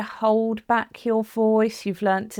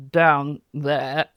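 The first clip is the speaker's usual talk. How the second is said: lower, slower, squashed-down voice; chin-dropped voice; 5 semitones lower than usual. Shown as a demonstration. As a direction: demonstrate a voice pushed down by the chin. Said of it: "will make your voice like that".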